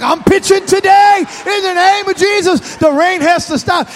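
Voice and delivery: put-on high, shouted kid declaration; a boy claiming the weather; confident boy. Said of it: "He's like".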